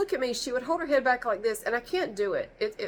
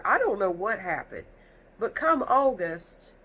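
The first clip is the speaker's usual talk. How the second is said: normal talking voice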